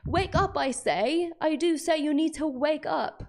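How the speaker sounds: high, loud mock-British proclamation; hammed-up British crier; attempted British accent. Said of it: "the best British accent I can do".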